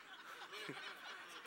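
a quick silent laugh; breathy laugh. Real, not acted. Pastor laughing to himself retelling his son. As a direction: laugh under your breath. Laughing before son's quote.

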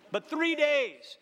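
"three days" drawn out slow, high and loud; stressed three days. Acted. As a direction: lean hard on "three days".

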